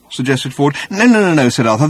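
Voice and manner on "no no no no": high, louder, frantic denial; overwhelmed, agitated. A narrator's reading. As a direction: wave the suggestion away in agitation. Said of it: "sudden access of emotion and bewilderment".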